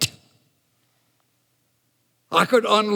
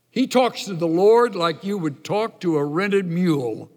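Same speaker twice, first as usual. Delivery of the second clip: normal talking voice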